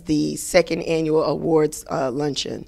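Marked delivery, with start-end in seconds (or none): none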